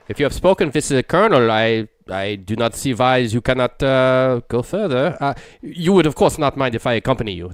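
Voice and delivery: put-on a light French accent; lightly French-accented servant. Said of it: "That French and good".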